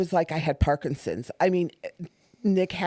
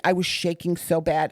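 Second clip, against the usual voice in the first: ordinary talk, nothing marked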